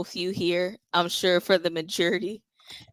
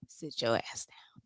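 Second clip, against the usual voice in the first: whispered command; 7 semitones lower, 12 dB quieter than usual. Retold or imitated. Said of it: "he was like".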